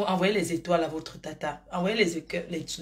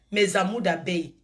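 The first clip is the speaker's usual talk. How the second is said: normal talking voice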